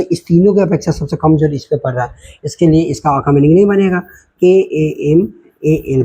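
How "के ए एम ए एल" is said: slow spelling. Shown as a demonstration. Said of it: "spelling out letters slowly".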